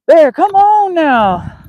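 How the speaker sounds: high, loud, drawn-out call to a dog. Real, not acted. Calling the dog in wind.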